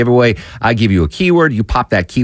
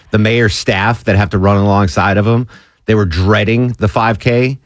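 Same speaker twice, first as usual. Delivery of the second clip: usual speaking voice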